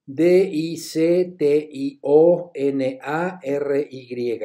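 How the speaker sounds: letters of dictionary spelled very slowly; teacher spelling for students. Shown as a demonstration. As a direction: spell each letter with a pause. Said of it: "spells dictionary".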